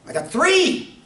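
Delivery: growing excitement at a second match; mock excited player. Ad character voiced in story.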